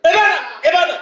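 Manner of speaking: high-pitched cry of authority; shouted command. Shown as a demonstration. Preacher coaches listeners on healing the deaf, shouted command. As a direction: call it out high, as an order. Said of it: "세 번 외쳐".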